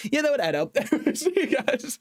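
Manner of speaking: high, laughing sign-off. Real, not acted. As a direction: talk through a laugh. Laughing while signing off.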